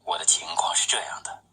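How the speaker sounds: ordinary delivery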